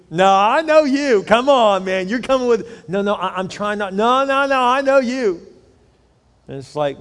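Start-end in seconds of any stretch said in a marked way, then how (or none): 0.1-5.4 higher, louder, slow insisting buddy; pushy friend. Retold or imitated. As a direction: badger him not to back out. Friend pressuring loudly.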